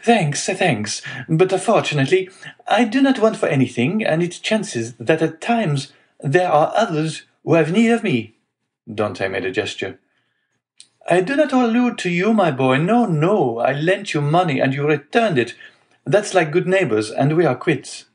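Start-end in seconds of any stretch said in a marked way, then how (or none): 0.0-8.2 hearty, wheedling neighbor in a high voice; Caderousse. A narrator's reading. Figure of visiting neighbor. 11.1-18.1 hearty, wheedling neighbor in a high voice; Caderousse. A narrator's reading. Figure of visiting neighbor.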